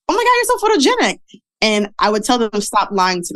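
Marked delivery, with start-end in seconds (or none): 0.1-1.2 gushing praise. Retold or imitated. People praising her photos.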